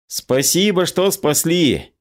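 high-pitched cry, drawn out at the end; effusive thanks. A narrator's reading. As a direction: call out thanks to rescuers in a high voice. Runs shouting to the attackers. (горячая благодарность высоким голосом)

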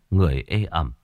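plain reading voice

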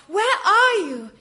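usual speaking voice

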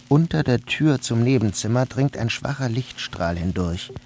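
ordinary delivery

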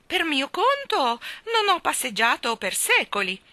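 put-on high, loud squawky old woman; eccentric old lady. Miss Calico boasting to the children, high and loud. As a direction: keep the shrill hen voice going.

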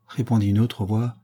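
ordinary delivery

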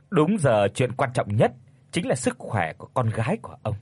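usual speaking voice

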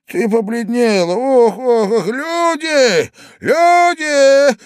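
high moans building to loud shouts; panicked cry. A narrator's reading. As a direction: call for help in mounting alarm. Husband panics, calls for help. (панический крик о помощи)

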